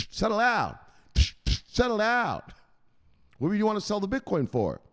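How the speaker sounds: high-pitched scolding enforcer; bossy enforcer. Acted. Enforcer confronting a seller, high scolding. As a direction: play the enforcer bearing down on someone. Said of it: "Andrew will smell it".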